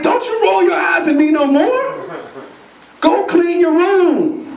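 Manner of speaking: high-pitched retold scolding, slowing on the order; mad parent. Dad to eye-rolling daughter, mad scolding. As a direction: scold the kid, pitch jumping up. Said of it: "I got mad and I was like".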